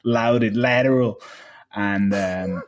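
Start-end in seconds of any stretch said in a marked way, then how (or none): 0.1-1.2 imitating the coach. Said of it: "he wanted me".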